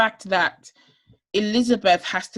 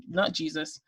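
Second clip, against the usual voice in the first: ordinary talk, nothing marked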